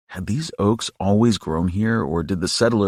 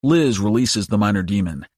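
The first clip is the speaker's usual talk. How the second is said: ordinary delivery, nothing marked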